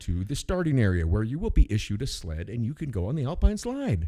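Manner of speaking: loud, clear instructions to a crowd; loudly voiced lift attendant instructions; lift operator spiel. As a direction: announce it like a ski lift attendant. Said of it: "I was like".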